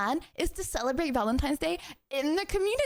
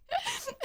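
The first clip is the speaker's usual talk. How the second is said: high giggle; 6 dB quieter than usual. Real, not acted. Laughing after message.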